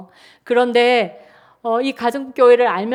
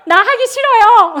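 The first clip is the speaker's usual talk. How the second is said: high, loud laughing protest; laughing refusal; 7 semitones higher, 6 dB louder than usual. Retold or imitated. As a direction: refuse through bursts of laughter. Wife refuses husband laughingly.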